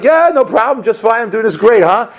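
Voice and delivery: high-pitched, breezy reassurance; mock breezy. Intern assuring HR all is great, mock breezy. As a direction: play it oblivious, pitched well up. Acting himself oblivious before HR.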